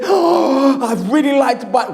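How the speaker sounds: high, fast, eager; can barely hold back; breathless longing. Retold or imitated. Customer yearning to buy the book, breathless.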